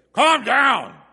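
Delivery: high, very loud shout; panicked yell. Shown as a demonstration. Showing how Moses would not address the people, yelled. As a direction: scream it at the crowd. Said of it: "He probably didn't say".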